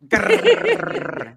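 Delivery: a high playful growl, loud; mock fierce grrr; growl imitation. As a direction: growl like a little cat showing attitude. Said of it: "And the grrr".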